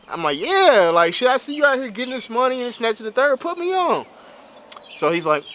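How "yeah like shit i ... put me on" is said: eager plea. Retold or imitated. Begging cousin to put him on.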